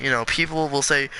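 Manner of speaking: ordinary talk, nothing marked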